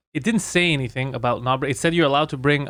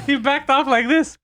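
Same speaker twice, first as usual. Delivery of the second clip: ordinary delivery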